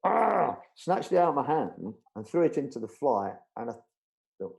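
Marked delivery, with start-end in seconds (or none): 0.0-0.6 high, loud exasperated "aargh"; imitated snarl of frustration; rough exclamation, snatching it away; angry growled cry. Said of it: "he went".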